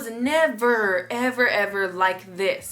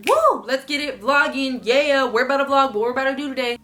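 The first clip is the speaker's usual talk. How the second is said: acted vlog hype, louder and higher; hyped-up; 4 semitones higher, 4 dB louder than usual. Replaying a good morning before vlogging, loud upbeat hype. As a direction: open with a whoop, then rally everyone. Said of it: "there will be certain days where I'm like".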